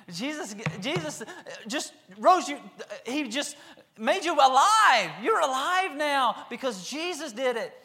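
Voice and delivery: high, loud, laughing joy with slow stretches; laughing joyful father. Acted.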